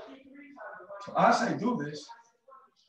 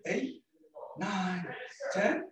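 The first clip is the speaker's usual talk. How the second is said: counting reps; 4 semitones higher, much slower than usual. Acted.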